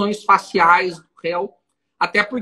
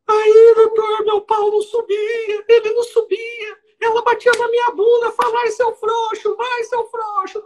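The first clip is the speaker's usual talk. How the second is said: much higher, wailing sobbing plea, hammed up; mock weeping defendant; 13 semitones higher than usual. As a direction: imitate the defendant crying out to the judge. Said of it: "E ele em pranto".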